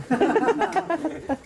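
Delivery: high, rippling laugh; amused laugh. Real, not acted. Joking that both have aged.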